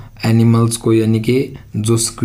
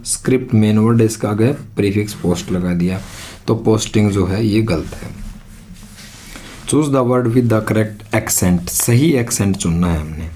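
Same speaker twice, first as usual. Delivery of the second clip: ordinary delivery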